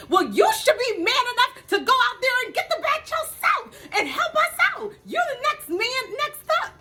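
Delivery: high laughing mock yell; yelling at Corey. Mother pushing her son to catch the bat, laughing high yell. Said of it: "I'm looking at Corey yelling at him".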